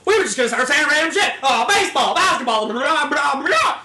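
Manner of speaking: loud, high, random word shouting; loud excited shouting. Real, not acted. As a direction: shout nonsense for fun.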